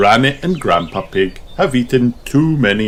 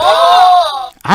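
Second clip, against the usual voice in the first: excited gasp; 4 dB louder than usual. A narrator's reading. Gasp before line.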